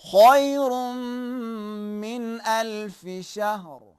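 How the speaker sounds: slow melodic recitation; Quranic recitation. Shown as a demonstration. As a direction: recite it slowly like in prayer. Teacher reciting example verse.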